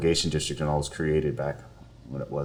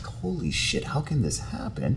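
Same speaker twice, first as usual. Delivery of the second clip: hushed, higher-pitched inner exclamation; stunned disbelief; 8 semitones higher, 6 dB quieter than usual. Retold or imitated. Lawyer watching a man in tears, hushed disbelief. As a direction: think it aloud, softer and higher, appalled. Stunned reaction to tearful couple.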